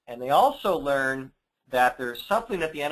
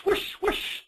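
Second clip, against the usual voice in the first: breathy high whooshing wind sound; windmill whoosh. Acted. Reading the windmill page, whispered high whooshing. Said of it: "Whirrs the windmill".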